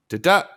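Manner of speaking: DA-DA rising to a loud, high second beat; unstressed then stressed. Shown as a demonstration. Teacher echoes an iamb, second syllable of DA-DA stressed. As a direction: punch the second half of DA-DA. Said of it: "An iamb is unstressed stress".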